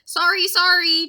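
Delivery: apologising while rushing by; loud flustered apology; yelled apology. Acted. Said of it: "Jack kept shouting".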